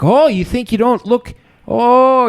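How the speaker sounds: high, loud mock angry lecture with a hint of Irish; Jordan Peterson impression. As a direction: ham up a mad professor scolding. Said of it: "It's almost Irish".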